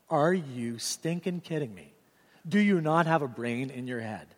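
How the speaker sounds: usual speaking voice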